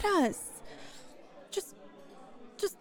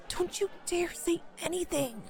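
normal talking voice

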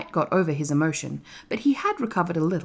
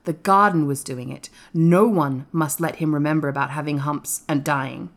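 ordinary delivery

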